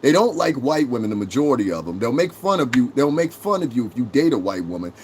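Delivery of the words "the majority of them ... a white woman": ordinary talk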